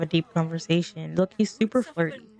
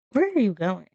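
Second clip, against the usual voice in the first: ordinary talk, nothing marked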